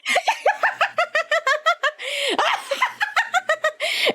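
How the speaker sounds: high-pitched, drawn-out cackle; cackling. Acted. Game master playing a character who starts cackling, high long cackle. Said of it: "he starts cackling".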